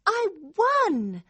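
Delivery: high, exultant cry; overjoyed. Acted. Girl fully believes she won, overjoyed. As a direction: burst with joy at winning. Joy bursts out.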